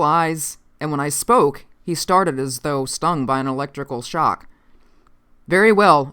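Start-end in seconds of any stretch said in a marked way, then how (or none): 5.5-6.1 louder, businesslike dismissal; brisk. A narrator's reading. Officer dismissing the sentry, brisk. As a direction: say it briskly and firmly, raised a little. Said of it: "I said briskly".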